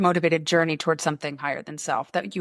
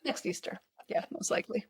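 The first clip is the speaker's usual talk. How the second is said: hushed wry aside; 12 dB quieter than usual. Real, not acted. Agreeing with the friend, whispered and dry. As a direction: drop to a near whisper, dry.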